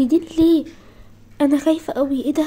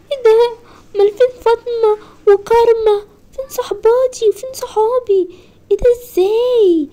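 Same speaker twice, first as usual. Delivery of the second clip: rising panic; 7 semitones higher than usual. Acted. Girl finds school empty.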